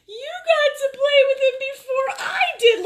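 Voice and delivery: very high, loud, teary jealous whine; mock crying whine. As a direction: wail it like a jealous kid about to cry. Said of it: "I'm like".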